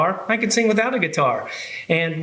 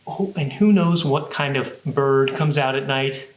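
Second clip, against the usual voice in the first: ordinary talk, nothing marked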